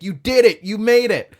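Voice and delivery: high, loud cheer of triumph; imitating an overjoyed friend shouting; excited congratulations. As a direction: celebrate a friend's big break. Comic congratulating him, retold.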